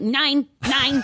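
high mock German accent on Nine, nine; German accent, hammed-up; mock German refusal. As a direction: snap it like a curt German clerk. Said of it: "they're like".

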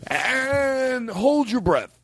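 high, stretched-out countdown-style command; drawn-out cue. Pretend. Host cueing everyone to hold their breath, drawn-out high call. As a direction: draw out the first word, then land it.

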